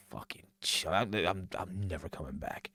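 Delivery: hushed, breathy aside under the breath; whispered thought. Retold or imitated. Punk muttering to himself that he's never coming back, whispered. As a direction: whisper it like a secret vow. Voicing Punk's private thoughts.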